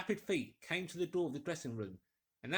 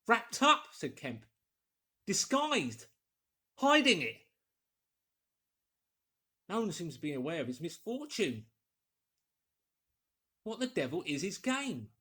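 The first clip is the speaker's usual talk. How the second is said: excited realisation; 4 semitones higher, 6 dB louder than usual. Acted. Kemp reading the Iping story.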